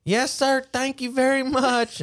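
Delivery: high, polite Alabama drawl; Southern kid accent. Retold or imitated. Kid thanks man for toilet paper, high Alabama accent. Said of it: "I don't know where that accent came from".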